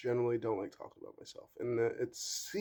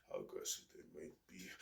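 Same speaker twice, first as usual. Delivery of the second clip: ordinary talk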